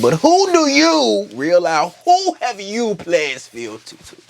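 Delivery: high, slow, haughty queen's challenge; mock queenly challenge. Rhaenyra to Daemon, a slow high mock challenge. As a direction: draw it out, looking him up and down.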